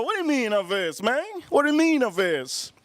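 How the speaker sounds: acted bewilderment; baffled protest. Kid baffled by the father.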